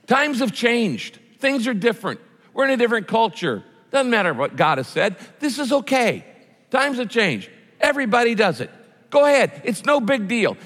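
high, coaxing tempter voice; Satan tempting. Acted. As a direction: purr the temptation like a sly devil. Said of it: "He goes".

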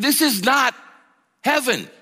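"This is not heaven" with "is" lifted high and held; emphatic, high stress on is. Acted. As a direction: lean hard on "is", then pause before "heaven". Preacher repeats point, stressed.

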